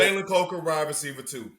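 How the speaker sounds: loud, pumped-up announcement; hyped shout. Acted. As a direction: call it out like a hype man. Hype call of a bold take.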